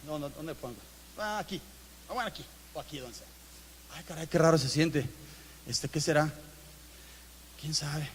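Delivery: murmurando para sí, muy bajito; preocupación callada. Retold or imitated. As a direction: como hablando solo, inquieto y en voz baja. Persona con dolor, voz interior. (se dice a sí mismo, bajito y preocupado, dónde le duele)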